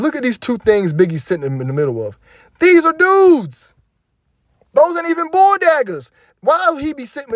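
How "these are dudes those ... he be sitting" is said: much higher and louder, dragged out in disbelief; can't believe what he's seeing, shouting it; stunned high-pitched exclaim; shocked outburst. Real, not acted. Outraged at the video.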